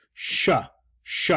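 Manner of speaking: a long unvoiced sh; sh sound. Shown as a demonstration. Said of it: "it's actually S H".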